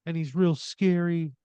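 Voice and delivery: put-on high-pitched upset kid voice; tearful child. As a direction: complain like a frightened little boy. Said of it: "he's kinda sobbing again".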